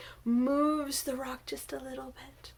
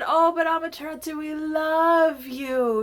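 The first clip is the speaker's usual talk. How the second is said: mildly pleading, a bit louder; coaxing plea; 3 semitones higher, 6 dB louder than usual. Acted. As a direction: coax her, stretching out the love. People coax the heartbroken goddess.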